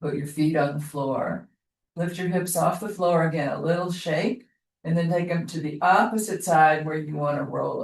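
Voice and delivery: calm, even instructor voice guiding the class; yoga class cue. Acted. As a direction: talk them gently through each movement. Yoga teacher guiding movement.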